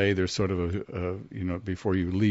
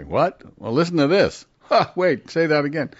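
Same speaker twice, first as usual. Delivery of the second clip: impatient listeners; 8 semitones higher, 6 dB louder than usual. Retold or imitated. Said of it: "people, you know, say".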